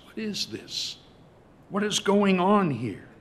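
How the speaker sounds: high-pitched puzzled questioning; baffled disbelief. Acted. Older brother hears music and dancing, baffled. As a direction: ask it high, in acted bewilderment.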